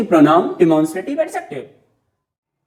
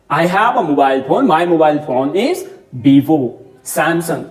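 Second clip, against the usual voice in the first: slow example; much slower than usual. Shown as a demonstration. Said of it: "आप कुछ भी बोलिए".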